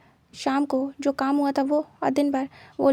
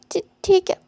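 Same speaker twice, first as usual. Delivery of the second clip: higher, louder, stuttering agreement; nervous stammer; 5 semitones higher, 5 dB louder than usual. Acted. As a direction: force out a scared okay. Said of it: "डरते हुए". (डरते हुए हकलाकर)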